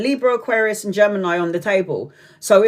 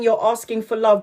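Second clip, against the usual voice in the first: ordinary talk, nothing marked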